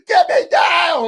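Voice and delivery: high, loud, wailing plea; hammed-up terrified kid crying on a ride; mock crying daughter. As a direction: wail it like a scared little girl. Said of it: "I had a daughter cry on that thing".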